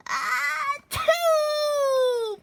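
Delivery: high loud achoo; loud sneeze. Acted. Another sneeze.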